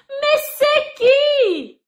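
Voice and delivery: high, loud, very slow wail of annoyance; shrill furious call through the door; furious shrieking woman. Acted. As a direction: scream it out, completely fed up. Woman's third, angriest call.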